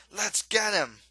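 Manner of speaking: rally the gang to charge; rallying shout. Acted. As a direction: hunter shouting the attack order. Hunter rallies others to attack.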